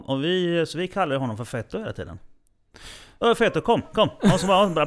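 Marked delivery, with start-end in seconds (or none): 3.2-4.1 ropande. Retold or imitated. Calling Fetto over in story.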